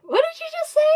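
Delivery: high, loud incredulous question; retold disbelief. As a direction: act out stunned disbelief. Retold disbelief at odd word.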